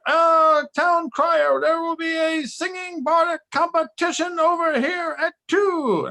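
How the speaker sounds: high, loud town crier call; hammed-up herald announcement. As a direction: proclaim it like a town crier to the crowd. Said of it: "somebody came out and was like".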